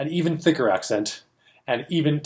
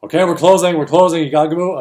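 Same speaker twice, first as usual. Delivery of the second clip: louder, higher call to clear the room; staff calling out; 5 semitones higher, 7 dB louder than usual. Retold or imitated. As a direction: herd the crowd out. Staff herding people out.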